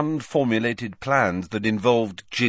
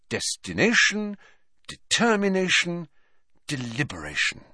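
high, measured chant, dropping on the last word; murmured recitation; 10 semitones higher than usual. A narrator's reading. Student rehearsing her test steps, lightly murmured recitation. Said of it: "she murmured".

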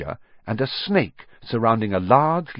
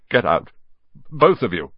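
croaked, halting order; croaking; 2 semitones higher, 5 dB louder than usual. A narrator's reading. Uncle sends both boys out, croaking. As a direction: croak it out in two pieces. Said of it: "croaked Uncle Vernon".

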